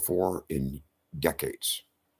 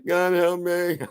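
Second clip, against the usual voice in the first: high, wailing fake cry for help; mock desperate plea; 10 semitones higher, 3 dB louder, slower than usual. Atheist crying to God in danger, mock desperate. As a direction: plead in an exaggerated high wail.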